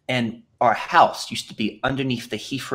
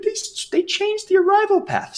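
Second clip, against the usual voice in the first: put-on very high, wailing kid voice; crying child; 5 dB louder than usual. Tearful boy runs to mom, very high wail. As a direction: run in wailing like a heartbroken little boy. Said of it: "I used to be in tears with my mom".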